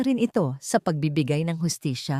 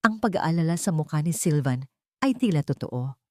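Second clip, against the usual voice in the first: ordinary delivery, nothing marked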